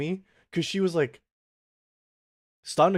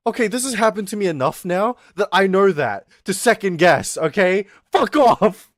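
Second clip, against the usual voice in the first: loud, high-pitched mock outburst; exasperated comeback; 7 semitones higher, 8 dB louder than usual. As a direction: build to an indignant shout at the friend. Exasperated comeback to friend's advice.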